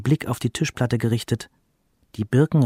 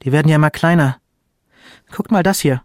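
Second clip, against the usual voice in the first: higher, accusing mother; sharp tone; 5 semitones higher, 4 dB louder than usual. A narrator's reading. Mother reproaches her sons over the bundles, sharp tone. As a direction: confront them with a sharp edge. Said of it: "der Ton wurde scharf".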